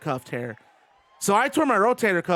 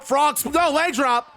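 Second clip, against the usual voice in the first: excited call; 7 semitones higher than usual. Real, not acted. Calling the move in the game.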